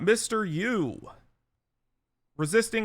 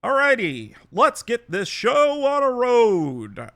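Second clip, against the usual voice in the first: loud sharp voice; 6 semitones higher, 6 dB louder, slower than usual. Acted. Judge opens the trial.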